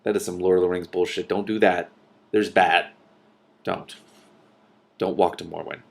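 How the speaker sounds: ordinary delivery, nothing marked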